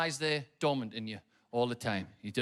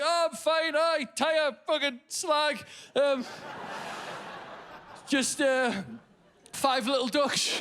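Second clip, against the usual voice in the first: tearful reply; 11 semitones higher, slower than usual. Retold or imitated. Crying dad answering the bin man, voice cracking high. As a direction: try to sound fine while sobbing. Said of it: "I was like".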